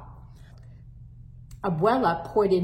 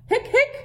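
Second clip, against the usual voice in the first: hiccup gasps; 5 dB louder than usual. Acted. Hector hiccuping loudly.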